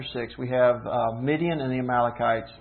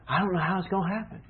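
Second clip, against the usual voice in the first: anxious; 5 semitones higher, faster than usual. Retold or imitated. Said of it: "That's anxiety".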